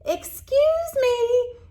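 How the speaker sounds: very high, slow tiny squeak. Acted. Lizard squeaks into the sleeping bear's ear, very high. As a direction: squeak it timidly. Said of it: "he squeaked into the bear's ear".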